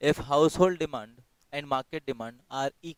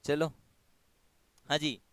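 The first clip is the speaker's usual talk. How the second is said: ordinary talk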